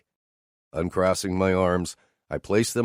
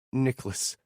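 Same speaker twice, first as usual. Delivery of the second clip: higher, quieter strained name; forced out; 6 semitones higher, 5 dB quieter than usual. Acted. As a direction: push the name out with effort. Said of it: "she forces out".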